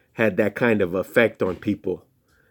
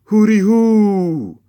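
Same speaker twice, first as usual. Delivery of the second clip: high, slow, loud call of hooty hoo; shouted sing-song hooty hoo; 12 semitones higher, 7 dB louder, slower than usual. Acted. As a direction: hoot it out across the city. Calling out to hero.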